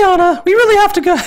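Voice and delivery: high falsetto, relieved and fretful; squeaky relieved voice; worried big sister. Acted. Elsa scolding lost Anna in a high, worried falsetto.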